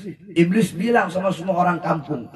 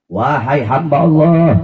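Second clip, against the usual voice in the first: seruan lantang; 10 dB louder, slower than usual. Retold or imitated. Ilham Allah kepada ahli ibadah.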